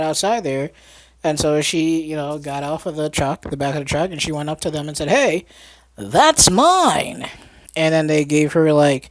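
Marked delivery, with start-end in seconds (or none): 5.1-7.3 raised, higher mock protest; mock indignant call. Girl to the posse who took her music box, mock indignant. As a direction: call it out like a wronged kid.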